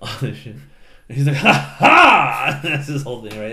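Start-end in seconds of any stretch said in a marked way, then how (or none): 1.4-2.6 loud, higher triumphant "Ha ha!"; cat swordsman's showy battle cry; swashbuckler flourish. Retold or imitated. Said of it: "His big flourish".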